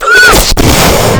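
loud high theatrical gasp; hammed-up horrified gasp; mock shocked gasp. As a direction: gasp in over-the-top fake horror.

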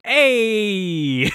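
a light New Yorker "Hey."; mock New York accent. Retelling a charades round of accents, mock New York "Hey.". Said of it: "Skylar got Canada and she said".

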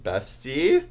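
normal talking voice

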